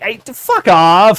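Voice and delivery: slow, loud mock snap; drawn-out goofy get-lost; hammed-up clone telling them off; mock brush-off.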